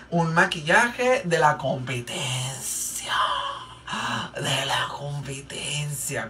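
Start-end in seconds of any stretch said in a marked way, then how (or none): 4.4-6.3 slow, hushed gasp of mock horror; scandalized whisper. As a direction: whisper it like a shocking secret, drawn out. Repite susurrando, como escándalo. (susurro lento y escandalizado)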